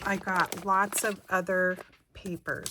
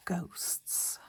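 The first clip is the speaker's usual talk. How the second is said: whispering; 5 dB quieter than usual. Pretend. Reading a spooky label.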